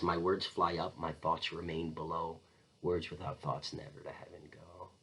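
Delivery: low, fading to a whisper; hushed prayer. Acted. Claudius praying alone about words and thoughts, hushed and fading. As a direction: let the words sink away to almost nothing.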